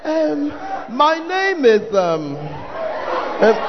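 drawn-out ums in a put-on voice; mock a nervous pretender introducing himself; halting, affected self-introduction; affected, hesitant suitor. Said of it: "even the M, M that you are saying".

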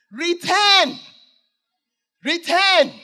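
high, loud ringing command; voice of the Lord. Acted. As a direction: call the lost children home with authority. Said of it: "the message the Lord has".

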